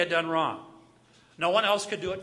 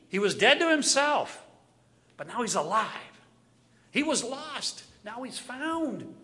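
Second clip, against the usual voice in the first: joyful father; 4 semitones higher than usual. Acted. Father rejoices over returned son.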